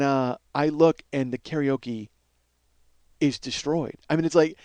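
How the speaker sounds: usual speaking voice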